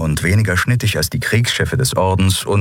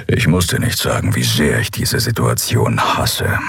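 low, slowing grumble; grumbling Skrier; 7 semitones lower than usual. Acted. Skrier complains to Endriel about the situation, low grumble. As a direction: grumble it deep, dragging the end.

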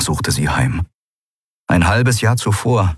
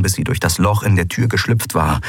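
usual speaking voice

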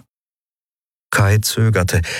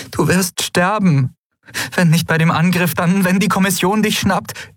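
high-pitched, anguished plea; worried, emotional insistence; distressed warning; 13 semitones higher than usual. A narrator's reading. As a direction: warn him, upset, voice pitched up. Endriel fears for Telios's life.

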